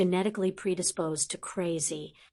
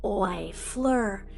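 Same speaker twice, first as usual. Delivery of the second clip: snapped. A narrator's reading. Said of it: "Ron snapped".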